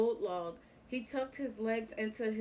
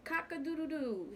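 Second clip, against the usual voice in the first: higher mock rooster crow; 6 semitones higher than usual. A narrator's reading. Rooster crowing against the wind, mock crow. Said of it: "he screamed".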